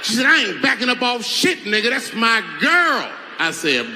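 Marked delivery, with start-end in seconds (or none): 0.3-3.1 aggressive, higher and louder; tough butch challenger. Retold or imitated. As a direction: step up and claim your girl. Same challenger refuses to back off.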